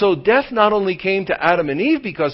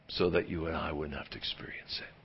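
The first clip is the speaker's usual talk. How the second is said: hushed, breathy whisper, much softer; 12 semitones lower, 17 dB quieter, faster than usual. Acted. Preacher confiding the heart of Christmas to the congregation, whispered. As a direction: lean in and whisper the good news. Sermon climax, voice drops.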